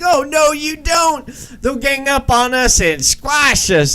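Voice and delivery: high, strained female voice protesting; frightened Connie. Retold or imitated. Connie protests in retold scene.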